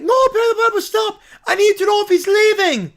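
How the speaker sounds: high, loud villain panic; frantic cartoon villain. Villain begging Perry to stop, frantic shout. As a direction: cry out in hammed-up villain alarm.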